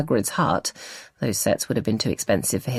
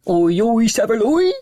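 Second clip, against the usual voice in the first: deep-voiced shout; 9 semitones higher, 6 dB louder than usual. A narrator's reading. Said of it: "Karen shouts in her deepest voice".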